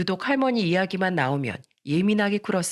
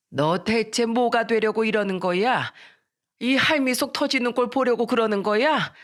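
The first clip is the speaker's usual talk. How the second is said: put-on higher-pitched old grandmother, exasperated; scolding grandmother; 4 semitones higher than usual. Grandmother scolding her troublesome granddaughter, exasperated. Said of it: "미란이를 꾸짖으면서 말씀하셨죠".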